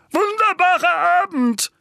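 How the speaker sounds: loud, high-pitched strained call; booming show of neighbourly greeting. A narrator's reading. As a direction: call it out far too loudly to the neighbours. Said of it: "rief Onkel Vernon".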